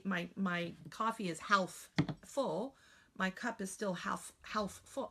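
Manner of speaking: "half" with the L sounded, said slowly; overarticulated "half" with the L said, as you'd never talk; spelling pronunciation. Shown as a demonstration. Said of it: "I don't talk like that".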